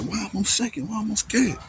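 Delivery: high, frantic retold panic; panicked self-question. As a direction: ask yourself in rising alarm why you're shaking.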